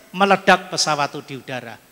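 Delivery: usual speaking voice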